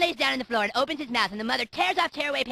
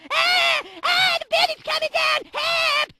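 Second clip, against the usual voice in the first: shrill panicked screams, much higher and louder; screaming; 5 dB louder, much slower than usual. Retold or imitated. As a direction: scream in mock terror.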